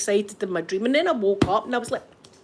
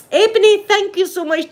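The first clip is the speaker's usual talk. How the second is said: cheery greeting; 10 semitones higher, 6 dB louder than usual. Real, not acted. Thanking a viewer by name.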